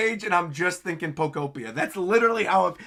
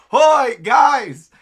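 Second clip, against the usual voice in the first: much higher, much louder cheerful call; 7 semitones higher, 13 dB louder than usual. Retold or imitated. Luffy calling out to his crew from afar. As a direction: shout it across the island. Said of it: "giant Luffy in the background going".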